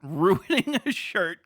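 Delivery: ordinary talk, nothing marked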